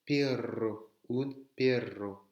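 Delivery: PERRO with a long trilled RR; double R roulé. Shown as a demonstration. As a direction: roll the R in perro. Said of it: "s'il y a deux R". (perro au R bien roulé)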